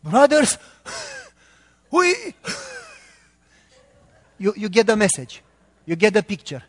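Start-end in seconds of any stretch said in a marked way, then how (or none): 0.0-2.1 put-on labored old preacher, each word pushed out loud and high; elderly pastor impression. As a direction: imitate an aged pastor heaving out single words between long pauses. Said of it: "And he was like".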